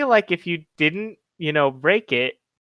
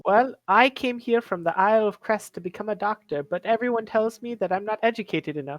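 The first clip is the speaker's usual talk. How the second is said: higher, affectedly polite character voice; trying to sound smart; 5 semitones higher than usual. Acted. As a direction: put on airs for a polite reply. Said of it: "What's wrong with your voice?".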